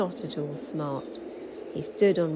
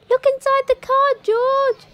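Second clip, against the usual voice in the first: very high, much louder excited chorus; eager animals; 10 dB louder than usual. A narrator's reading. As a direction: animals bursting to share a surprise. Animals urging George.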